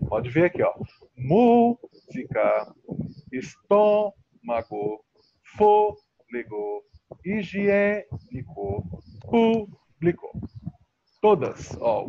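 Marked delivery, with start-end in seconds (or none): 1.2-10.3 "Música, estômago, fôlego, higiênico, público" with MÚ, TÔ, FÔ, Ê, PÚ stretched high; stressed antepenult. Shown as a demonstration. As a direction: hold each accented syllable long, as in MÚ-sica, ES-TÔ-mago, FÔ-lego, higi-Ê-nico, PÚ-blico. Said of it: "Toda proparoxítona tem acento". ("Música, estômago, fôlego, higiênico, público" com a antepenúltima sílaba longa e forte)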